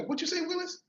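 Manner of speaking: high-pitched comic catchphrase. Retold or imitated. Joking aside quoting a TV show, catchphrase delivery. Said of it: "Remember T V show?".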